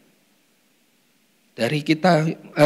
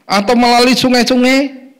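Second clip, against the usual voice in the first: loud preaching; 9 semitones higher, 7 dB louder than usual. Real, not acted.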